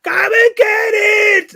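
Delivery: very high, loud, slow shout; imitating jingle shout. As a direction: belt it like the radio ad. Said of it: "then it went".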